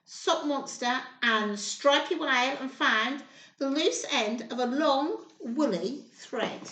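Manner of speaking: ordinary delivery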